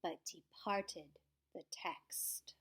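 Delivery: slow, hushed aside; whisper. A narrator's reading. Narrator whispering a secret to listeners. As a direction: whisper it slowly, conspiratorial.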